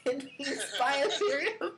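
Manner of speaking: words broken up by a laugh; chuckling as he repeats it. Real, not acted. Host repeats cohost's answer laughing.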